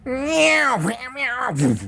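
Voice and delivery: high squeaky repeated meowing; string of meows. Retold or imitated. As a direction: yowl like a spinning cat. Mock curling commentary of cat.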